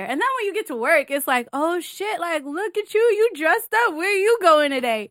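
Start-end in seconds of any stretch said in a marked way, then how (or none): 1.5-5.1 high, teasing admiration of an outfit; mock-impressed, higher than usual; impressed coworker. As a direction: voice a nosy coworker, pitched up. Voices coworkers admiring her look.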